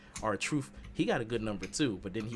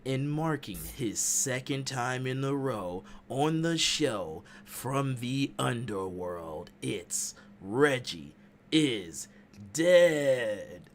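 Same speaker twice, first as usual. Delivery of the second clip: slow, building ring intro ending loud; boxing ring announcer; 2 semitones higher, 6 dB louder, much slower than usual. Pretend. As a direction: build up to a booming finish. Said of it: "Ring announcing is, is real".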